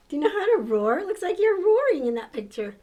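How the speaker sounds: usual speaking voice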